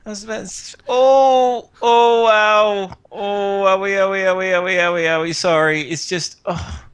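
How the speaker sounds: high, groaning sickbed voice; moaning invalid. Acted. August greeting visitors from his deathbed, moaning in mock pain. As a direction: whimper through fake back pain, hamming it up. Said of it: "And he goes".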